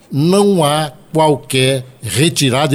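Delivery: slow, measured words; each word set apart deliberately; speaking slowly. Real, not acted. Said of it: "eu vou falar pausadamente".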